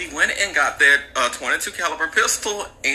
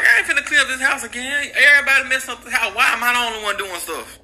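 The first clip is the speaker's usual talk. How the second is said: put-on annoyed teen; 10 semitones higher, faster than usual. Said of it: "And he was like".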